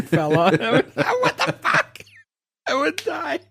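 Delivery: high, laughing disbelief. Real, not acted. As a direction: squeal it through laughter. Chicken falling on him, laughing.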